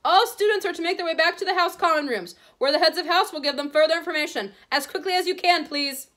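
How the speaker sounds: shouting through megaphone. A narrator's reading. McGonagall ordering the stadium through a megaphone. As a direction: project to a packed stadium. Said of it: "continued to shout through her megaphone".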